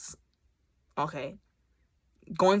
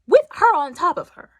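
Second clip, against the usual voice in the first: excited; 10 semitones higher, 8 dB louder than usual. Real, not acted. Excited retelling.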